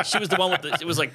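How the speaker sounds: ordinary talk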